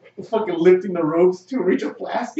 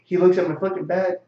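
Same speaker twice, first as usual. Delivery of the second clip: ordinary delivery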